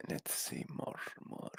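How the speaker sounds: near-whispered, unvoiced aside; muttering to self. Real, not acted. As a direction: think aloud under your breath.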